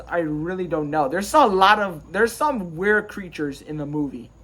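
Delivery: ordinary talk, nothing marked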